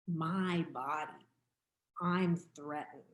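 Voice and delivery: usual speaking voice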